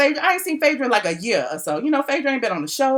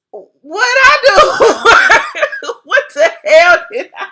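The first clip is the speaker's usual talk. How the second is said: high, loud, laughing protest; mock innocent protest; 9 semitones higher, 8 dB louder, much slower than usual. Blogger protesting she did nothing to Phaedra, mock outrage. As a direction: wail your innocence through laughter. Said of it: "I be acting so innocent".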